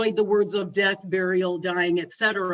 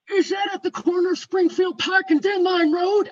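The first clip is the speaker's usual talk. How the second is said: high, loud imitated gentleman; loud, emphatic questioning, put on as the caller; insistent caller; 8 semitones higher, 5 dB louder than usual. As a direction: demand confirmation in a raised voice.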